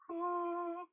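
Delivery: high, drawn-out hoo; held owl hoot. Shown as a demonstration. Teacher demonstrating a held tone to the class, held owl hoot. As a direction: hold one high hooting tone. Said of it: "Hold the hoo".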